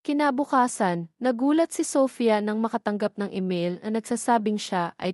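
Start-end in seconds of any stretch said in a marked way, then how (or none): none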